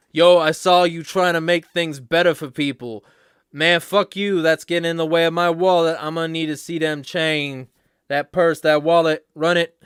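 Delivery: loud street-mugger voice; street talk. Acted. Mugger shaking down a would-be peacemaker, in street talk. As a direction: play a mugger demanding the goods. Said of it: "Jeremiah Street Talk".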